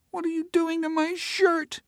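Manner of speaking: put-on small high whimpering child voice; a little girl upset over her shirt. Emma whimpers at Teresa over her shirt. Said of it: "Emma whimpered".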